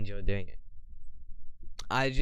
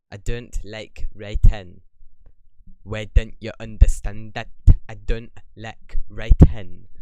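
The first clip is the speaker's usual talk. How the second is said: put-on loud, slow Scottish accent, insisting; stubborn Scottish protest, hammered out slowly; 10 dB louder than usual. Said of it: "I don't know why that was Scottish".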